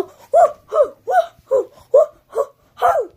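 very high, loud, short whoops; excited string of falsetto woo-hoos; high-pitched whooping. Shown as a demonstration. Fan showing reaction to seeing Jimmy, high whooping. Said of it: "I just go".

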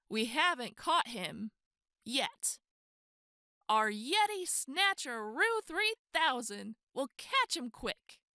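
ordinary delivery, nothing marked

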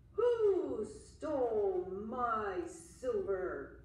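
slow, loud, looming monster voice; menacing monster. Acted. Monster hunting the boy who stole its silver, slow and loud. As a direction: drag each word out like a beast on the hunt. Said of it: "the monster asked again".

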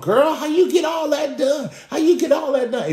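high, loud gushing imitation; mock amazed admiration. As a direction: shout it like an impressed girlfriend. Acts out admirers applauding the overworker.

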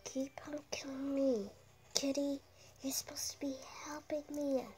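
hushed whisper while hiding. Real, not acted. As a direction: whisper so nobody hears.

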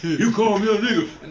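indignant, hammed-up comeback at the name-caller; mock angry. Shown as a demonstration. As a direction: act out getting mad at the insult. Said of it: "but if you go get mad".

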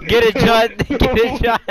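high, laughing encouragement; excited cheering on. Real, not acted. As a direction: egg your friend on through laughter. Cheering friend on in game.

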